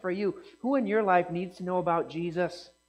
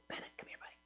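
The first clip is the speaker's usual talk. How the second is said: whispered aside; 23 dB quieter, much faster than usual. Real, not acted. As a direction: whisper it off to the side. Aside calling a child over.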